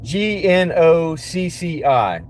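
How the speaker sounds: "G N O C C I" spelled letter by letter, very slowly; spelling it out. Shown as a demonstration. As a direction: read out "G N O C C I" one letter at a time, drawn out. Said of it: "a word I don't know how to say".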